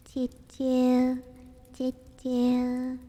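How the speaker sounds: loud high choo choo; loud train whistle. Pretend.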